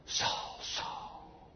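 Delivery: hushed, whispered call; eerie, drawn-out whisper; voice from heaven. Acted. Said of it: "he heard a voice say to him".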